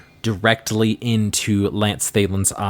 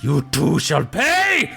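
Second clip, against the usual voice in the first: higher, drawn-out vengeful threat; menacing villain; 5 semitones higher than usual. Acted. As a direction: snarl it slowly as a vengeful villain. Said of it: "looks at you, Fleeple, and goes".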